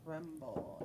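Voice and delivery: normal talking voice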